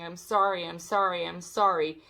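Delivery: normal talking voice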